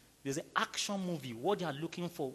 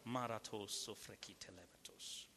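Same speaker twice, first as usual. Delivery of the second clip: whispered tongues; 8 semitones lower, 12 dB quieter than usual. Real, not acted. Praying in tongues.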